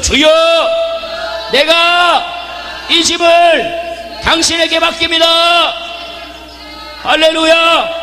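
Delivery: 크고 느리게 외치는 기도; loud drawn-out prayer. Shown as a demonstration. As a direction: stretch every word as you cry it out. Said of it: "큰 소리로".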